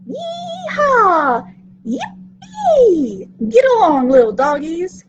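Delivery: high, loud yee-haw whoops; cowboy whoops. Acted. Little Carr whoops at the rodeo cowboys, loud. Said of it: "Little Carr shouted".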